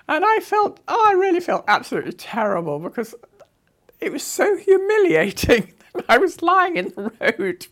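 high, loud, laughing through the words; laughing embarrassment. Real, not acted. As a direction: laugh at your own humiliation. Laughing at her humiliating fall.

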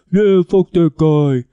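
loud, drawn-out mock cheer; hammed-up slow yell of agreement; rowdy cheering. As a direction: imitate a crowd's rowdy approval, slowly. Mimics everybody cheering an attack post.

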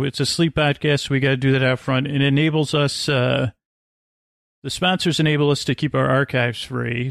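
usual speaking voice

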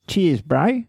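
mock Australian outback greeting; bad Australian accent. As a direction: attempt an Australian accent and miss. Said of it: "This is like the worst, uh, accent".

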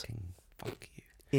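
whispered mock curse under the breath; whispered swearing. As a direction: swear softly, almost without voice. Muttered swearing under co-host's point.

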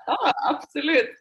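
imitating friend's eager laughing yes. Friend's reply, retold laughing.

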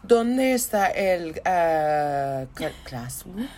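put-on slow, faltering Spanish accent; put-on schoolkid Spanish. Back from summer holidays asking teacher for classroom, put-on Spanish. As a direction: grope for the word in showy Spanish. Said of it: "coming back to school and being".

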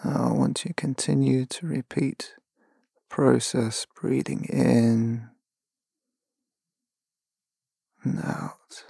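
calm, unhurried meditation voice with long pauses; slow, spacious relaxation guidance; guided meditation. Acted. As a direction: guide them gently into stillness, phrase by phrase.

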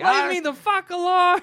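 high, hammed-up annoyance; aggressive surgeon. Surgeon brushing off staff to watch football, mock aggression. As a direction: pitch it way up, mock belligerent. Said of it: "that guy is very aggressive".

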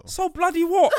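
high, loud mock outrage; indignant mum. Tourist mum snaps at the businessman, high and loud. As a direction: squawk it back like the offended mum. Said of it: "the mother was like".

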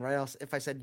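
ordinary delivery

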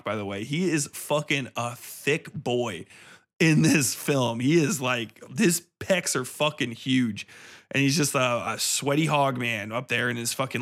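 usual speaking voice

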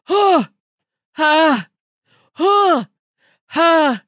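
loud, effortful Wimbledon grunts; imitated tennis grunts. Co-host imitating the sound of Wimbledon, loud tennis grunts. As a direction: grunt like a player hitting each shot. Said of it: "it's a lot of like".

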